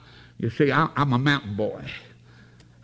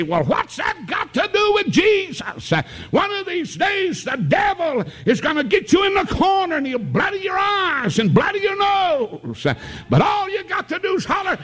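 preaching at full force. Acted. Preacher exalting Jesus to the congregation, full-force preaching. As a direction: lift it up and ring it out to the church.

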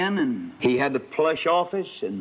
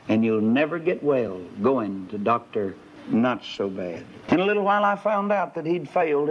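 usual speaking voice

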